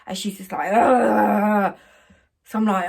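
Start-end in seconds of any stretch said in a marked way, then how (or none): 0.7-1.8 loud mocking wailing groan; mock drunken wail. Imitating the drunk bride's noise.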